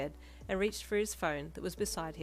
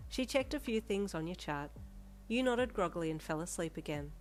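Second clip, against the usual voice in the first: plain reading voice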